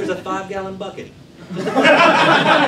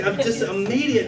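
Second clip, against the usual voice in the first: ordinary talk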